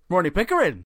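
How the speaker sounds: first rung of a mock shouting match; mock proud announcement. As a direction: announce the name as if famous. First of three escalating name shouts.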